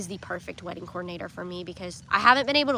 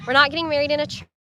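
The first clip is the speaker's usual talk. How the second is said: rushed through a laugh, higher; laughing, fast; 6 semitones higher, 7 dB louder, much faster than usual. Real, not acted. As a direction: blurt it out laughing. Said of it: "laughs before repeat".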